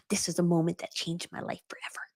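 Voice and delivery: hushed whisper, letting them in on it. Real, not acted. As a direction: breathe it like a secret. Confiding the life-changing moment.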